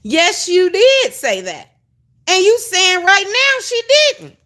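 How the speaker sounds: high-pitched, incredulous mock confrontation; indignant contradiction. Confronting someone who denies saying it, indignant. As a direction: call out the denial, pitch climbing. Acts out confronting her denial.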